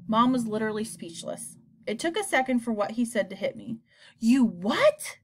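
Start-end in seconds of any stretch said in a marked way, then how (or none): 4.2-5.2 sharp shocked question; shocked he'd do it; stunned disbelief. Acted. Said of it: "I said".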